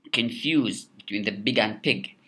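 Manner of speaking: normal talking voice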